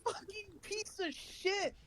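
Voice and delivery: high-pitched outraged yelling, drawn out at the end; mock angry yell. Player yells at the thief of the kill, high mock-angry yell. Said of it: "I just yell at".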